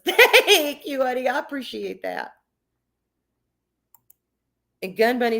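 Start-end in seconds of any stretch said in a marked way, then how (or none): 0.1-2.3 laughing thanks. Real, not acted.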